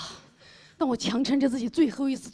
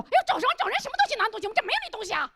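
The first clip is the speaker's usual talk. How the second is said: much higher, fussy shopkeeper woman; shrill shop lady; 10 semitones higher, much faster than usual. Acted. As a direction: fluster like a busybody shop owner. Shop owner's wife rushes over.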